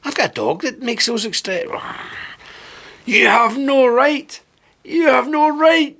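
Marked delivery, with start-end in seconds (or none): 3.0-4.4 slow, higher growled mock of the girl; mock growling Greta. Mocking Greta's speech to viewers, slow growl. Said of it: "she's growling at people". 4.8-6.0 much higher, slower mock growl; mock growling Greta, higher. Mocking Greta's speech again, higher whiny growl. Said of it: "she's growling at people".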